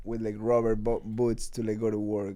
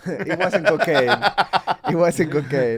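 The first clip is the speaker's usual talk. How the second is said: loud, higher, through real laughter; laughing; 5 semitones higher, 9 dB louder than usual. Real, not acted. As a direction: laugh while insisting.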